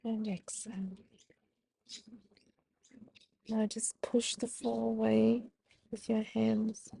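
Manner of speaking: gentle yoga instructor voice; guided breathing cues. Acted. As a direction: talk them calmly through each breath. Leads the cat-cow flow.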